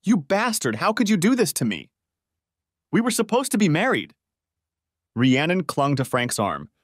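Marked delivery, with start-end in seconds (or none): 0.0-4.1 high, raw angry yell. A narrator's reading. Melanie yelling at Frank who betrayed her, high and angry. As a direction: scream your betrayal at him. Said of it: "she started yelling at Frank".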